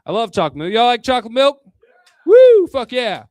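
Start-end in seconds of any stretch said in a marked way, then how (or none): 2.2-2.7 loud, high-pitched "woo"; excited whoop. Real, not acted. Comedian cheering chocolate milk with the audience, excited whoop. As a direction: whoop it up like a crowd.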